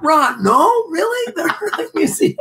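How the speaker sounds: mock disbelief. Teasing his co-host about the museum, squeaky high mock disbelief. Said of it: "I was like".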